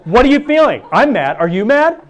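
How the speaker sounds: loud, high, heated mock quarrel; hammed-up breakup fight; mock angry exchange. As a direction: push the ex, get snapped back at. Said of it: "isn't that so welcoming".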